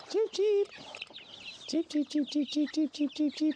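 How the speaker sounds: very high squeaky cheeps; high chick calls. Real, not acted. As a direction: chirp like a chick to call them. Calling baby chicks.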